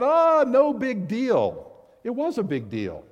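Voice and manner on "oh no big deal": high, slow mock dismissal; dismissive mock shrug. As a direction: brush it off lightly with a high voice. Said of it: "It's not".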